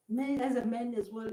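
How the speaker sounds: ordinary talk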